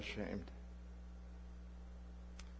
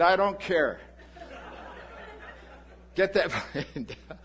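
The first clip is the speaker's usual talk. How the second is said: louder, higher mock outrage breaking into a laugh; laughing mock demand; 6 semitones higher, 5 dB louder than usual. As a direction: play the selfish demand, laughing at yourself. Selfishly demanding landing, laughing.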